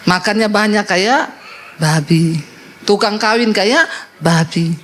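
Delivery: mengejek "babi" dengan nada turun dan lambat; meniru orang mengejek. Retold or imitated. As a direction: tirukan omongan orang tentang babi, pelan. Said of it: "orang bilang". (meniru orang menghina babi, lambat)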